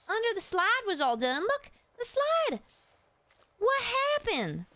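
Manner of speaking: panicking over lost progress, voice shooting up; freaking out. Real, not acted. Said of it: "I'm freaking out here".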